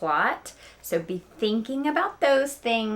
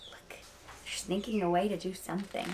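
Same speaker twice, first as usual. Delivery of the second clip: usual speaking voice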